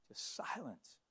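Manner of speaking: dropped to a whisper; sudden breathy hush after the shouts. A narrator's reading. Preacher tells of the silence in the rubble, whispered.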